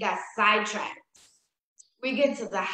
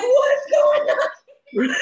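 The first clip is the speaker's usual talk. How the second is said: much higher, loud, slow, laughing yell; shocked friend; 9 dB louder, much slower than usual. Retold or imitated. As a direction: shriek in disbelief. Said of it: "my friend's like".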